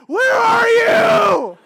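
high, loud, drawn-out shout; yelled question. Retold or imitated. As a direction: call out across the golf course, slowly. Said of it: "and then yelled".

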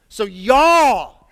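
\"y'all\" loud with Kentucky drawl. Shown as a demonstration. Preacher to congregation, Kentucky accent. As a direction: punch \"y'all\" in Kentucky style. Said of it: "how do we say plural you in Kentucky".

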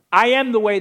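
loud ringing declaration; proclaiming Jesus' words. Acted. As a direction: call it out like a preacher's climax. Quoting Jesus emphatically.

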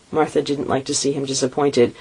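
ordinary delivery

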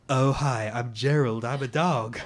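low dog voice in bad American accent; deep, simple dog with fake American accent; Gerald. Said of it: "sort of bad American accent".

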